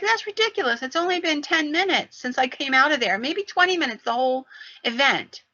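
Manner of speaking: high-pitched, dismissive disbelief; incredulous protest. Retold or imitated. Wife disputing her husband's timing, incredulous protest. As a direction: argue back in disbelief, voice lifted high.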